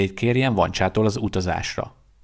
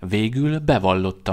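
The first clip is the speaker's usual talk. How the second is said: ordinary delivery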